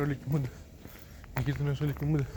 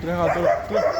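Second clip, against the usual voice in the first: louder, higher call to run; urging shout; 3 semitones higher, 6 dB louder than usual. Real, not acted. Calling the dogs to run, a louder higher urging shout.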